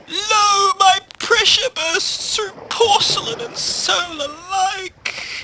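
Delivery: very high, drawn-out tragic wail; melodramatic tsunami. Acted. Tsunami lamenting to Debbie, high theatrical wail. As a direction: declaim it slowly like a wailing poet.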